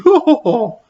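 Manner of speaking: high cruel ha ha ha; evil cackle. Acted. Matron laughing at the porter, evil cackle. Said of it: "laughed Matron".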